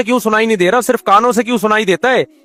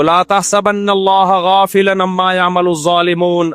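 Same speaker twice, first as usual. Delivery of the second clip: Quranic recitation; slower than usual. Acted. Quoting Quran in sermon.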